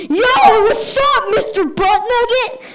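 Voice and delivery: put-on loud, high kid's yell. Kid yells his teacher's nickname across the room. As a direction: bellow it across the classroom. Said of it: "just goes".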